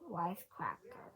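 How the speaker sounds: ordinary delivery, nothing marked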